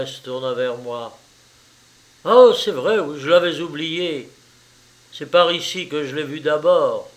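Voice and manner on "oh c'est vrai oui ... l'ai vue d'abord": startled, raised-voice recollection; sudden realisation. A narrator's reading. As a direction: exclaim it as it comes back to you. Startled character suddenly remembers the light.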